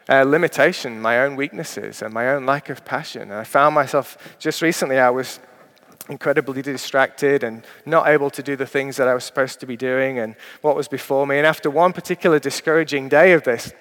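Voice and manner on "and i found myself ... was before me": usual speaking voice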